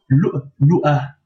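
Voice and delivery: ordinary delivery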